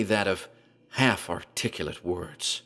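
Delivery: plain reading voice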